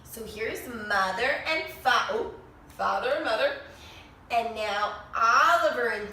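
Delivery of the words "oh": ordinary delivery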